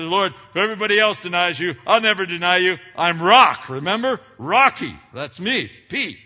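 high, loud, fast cocky Peter voice; Peter boasting. Retold or imitated. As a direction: play Peter puffing himself up as the rock. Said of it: "Peter chimed in and said".

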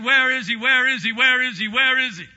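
high, loud, repeated demand; insistent searching. Retold or imitated. Mary searching for Jesus, undeterred, loudly insistent. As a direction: call it out urgently over and over.

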